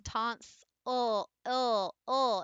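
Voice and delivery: tonsil broken into tons, ul, ill, ul; slow tonsil down piece by piece; slowed-down syllables. Shown as a demonstration. Teacher slowing the word so students hear I-ul. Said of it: "If you slow it down".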